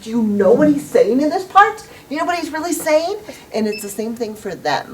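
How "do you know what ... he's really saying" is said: high, rising, animated questions; lightly hammed-up eager questioning; eager fan. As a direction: excitedly quiz a friend about the lyrics. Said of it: "and you're like".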